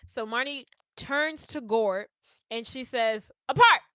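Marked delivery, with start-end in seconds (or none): 3.5-3.8 high, punchy exclamation; more oomph. Retold or imitated. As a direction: say it with real force, sudden and high. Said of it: "a little bit more umph".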